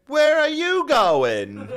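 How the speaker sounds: high slow mock old woman squawk; hammed-up old lady, high and drawn out; squeaky old lady. Old woman voiced in story.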